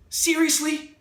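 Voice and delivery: high, exasperated Seriously; incredulous at the failed plan; disbelief. A narrator's reading. Narrator exasperated at failure.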